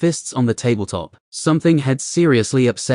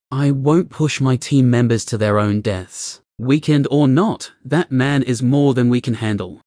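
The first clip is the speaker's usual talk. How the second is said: usual speaking voice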